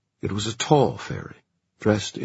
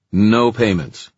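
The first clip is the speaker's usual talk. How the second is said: firm refusal; 5 semitones higher, 8 dB louder, slower than usual. Acted. Julian refusing firmly.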